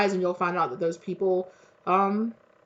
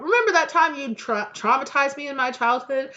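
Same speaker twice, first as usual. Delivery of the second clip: light, high, louder mock-innocent question; mock casual accusation; 7 semitones higher, 4 dB louder than usual. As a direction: toss it out like a breezy jab. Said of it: "casually drop".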